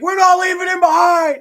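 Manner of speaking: high, loud, urgent re-enacted yell; hammed-up heroic refusal, shouted; rallying shout. Retelling refusing to leave Patrick in the game, shouting.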